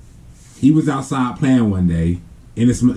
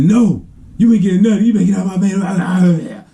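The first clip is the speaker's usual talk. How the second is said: high mock rant running into garble; mad uncle; 9 semitones higher than usual. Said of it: "he gets to get mad".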